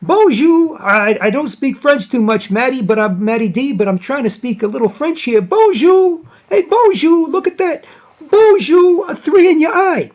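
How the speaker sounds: high, excited broadcaster impression, loud bonjours; Jack Armstrong. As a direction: crow it like a commentator faking French. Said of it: "he'll sometimes say".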